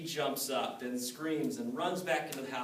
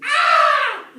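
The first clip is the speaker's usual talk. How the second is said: piercing high shriek; ghost's high scream; 24 dB louder than usual. Acted. Frightened ghost screaming as it flees, high shriek.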